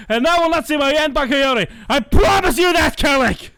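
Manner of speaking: screamed high and loud, line after line; furious villain rant. Acted. To top his friend's reading, he screams the villain's rant. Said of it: "I can put more feel into this than you can".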